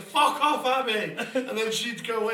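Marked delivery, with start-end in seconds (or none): 0.0-1.2 high, loud laughing shout; drunken yell. Retold or imitated. Drunk mate yelling his girlfriend out, high laughing shout. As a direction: shout her away, drunk and furious. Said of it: "he was like".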